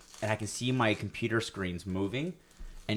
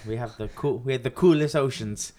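put-on botched Hawaiian accent; 5 semitones higher, 3 dB louder than usual. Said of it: "from Hawaiian to Irish".

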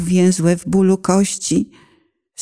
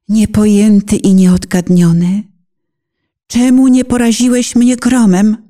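anguished outcry; 6 dB louder than usual. Acted. Penitent mother cries out to God why He spared her, anguished and loud. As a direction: hurl the questions up at God in anguish.

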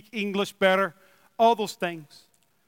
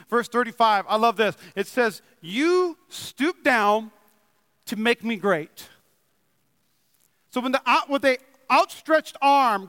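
excited preaching; 4 semitones higher than usual. Real, not acted. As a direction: preach it with full passion, lifting the whole room. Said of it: "I'll calm down. I'm just excited".

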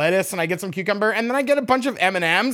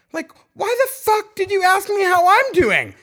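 exasperated rant; 10 semitones higher, 4 dB louder than usual. Real, not acted. Ranting about nosy cashier.

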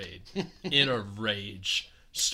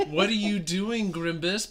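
higher, scolding aunt voice; disappointed aunts; 7 semitones higher than usual. Retold or imitated. Aunts scolding Grimace, higher and disappointed. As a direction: scold him like a let-down aunt. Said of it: "They're so disappointed in him".

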